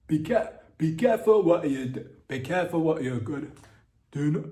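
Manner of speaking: a put-on Nigerian accent, mock ominous. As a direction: ham up a Nigerian Snape warning against dark powers. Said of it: "my Nigerian accent".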